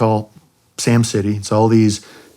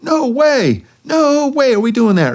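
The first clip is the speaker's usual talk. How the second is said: very high, indignant refusal in a mock Puerto Rican accent; Willie; slower than usual. Wingman told to go back for the general, excited Puerto Rican accent. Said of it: "In that thick accent".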